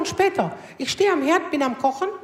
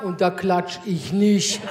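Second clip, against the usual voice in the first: low, slow blokey voice; a low, slow mock of a stubborn man; mock honest bloke; 9 semitones lower, slower than usual. Imitating an honest guy.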